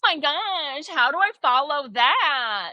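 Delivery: high, slow, self-pitying whine; exaggerated worried fretting; mock insecure speaker. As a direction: mimic a speaker fishing for reassurance. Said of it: "the next person goes".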